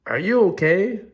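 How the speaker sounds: high, rising check on a friend; concerned question. Acted. Piggy checks on sneezing Gerald.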